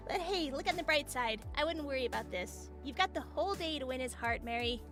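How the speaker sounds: soft, gentle encouragement; softly reassuring; consoling friend. Acted. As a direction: cheer up a friend, quietly. Friend consoles embarrassed girl.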